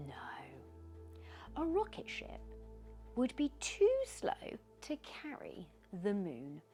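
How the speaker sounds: ordinary delivery, nothing marked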